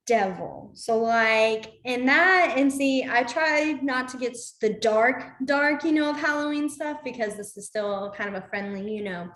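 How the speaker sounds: normal talking voice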